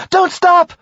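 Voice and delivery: high loud urgent shout; yelling. Acted. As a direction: scream the order at the driver. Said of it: "Jackie yelled".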